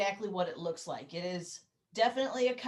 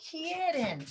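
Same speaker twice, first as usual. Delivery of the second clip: normal talking voice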